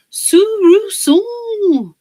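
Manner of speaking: high, slow, loud sung-out phrase; playful high sung call; sing-song call. Real, not acted. Sudden high drawn-out call.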